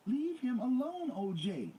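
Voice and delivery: high, slow, loud shout. A narrator's reading. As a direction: yell it out, drawn out. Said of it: "Nicole shouted".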